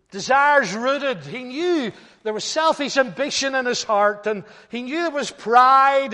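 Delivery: full-voiced sermon passage; preaching. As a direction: preach it home to the congregation. Preaching with force.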